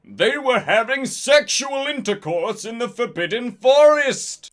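high-pitched outraged accusation; furious yell. A narrator's reading. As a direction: yell the scandal out in fury. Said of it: "He yelled in a furious voice".

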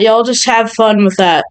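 usual speaking voice

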